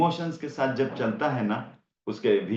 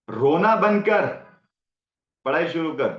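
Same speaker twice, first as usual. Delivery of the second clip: put-on loud, high and sharp, ordering the child to stop crying; harsh parent scolding; 5 semitones higher, 5 dB louder than usual. Fed-up parent scolding a crying child, loud and sharp. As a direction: bark at the kid like a fed-up parent.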